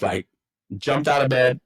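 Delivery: usual speaking voice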